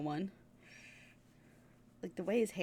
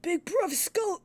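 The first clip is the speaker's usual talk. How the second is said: slow higher brother; 9 semitones higher, 4 dB louder, slower than usual. Acted. Brothers coin a nickname.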